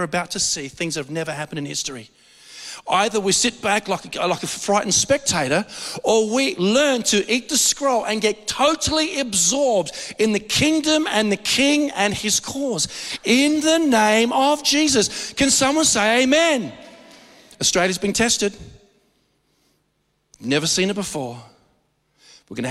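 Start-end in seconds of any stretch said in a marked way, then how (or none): 6.0-16.7 rising, slowing preacher's call; preaching. Acted. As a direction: build the sermon to a climax. Preacher building to climax.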